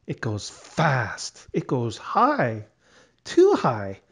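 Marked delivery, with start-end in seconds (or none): none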